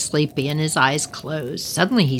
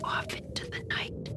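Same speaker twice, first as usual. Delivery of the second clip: soft breathy trailing whisper; whispered fade; 15 dB quieter than usual. A narrator's reading. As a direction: let the goblin vanish in a whisper. Goblin flies off into night.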